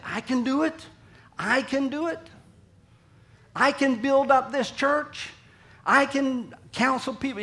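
high, insistent, rising boast; boastful self-confidence. Acted. Voicing self-confident strong people.

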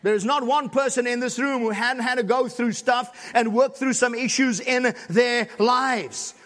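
ordinary delivery

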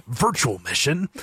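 half-hearted imitation of a game hero; lightly put-on soldier voice; terrible Snake impression. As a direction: do a bad mock action-hero line. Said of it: "that was a terrible impression".

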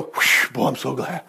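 soft, low sigh of pretend relief; mock relief. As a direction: exhale a whew, then drop low and quiet. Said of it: "Elijah didn't go".

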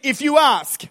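loud, slow declaration in short phrases; emphatic call-and-response preaching; leading a congregational repeat. Real, not acted. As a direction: proclaim it for the crowd to echo. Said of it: "say this after me".

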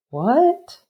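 high rising disbelief, retold; astonished. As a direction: imitate a sister's surprise, pitch sweeping up. Retold sister's shock at news.

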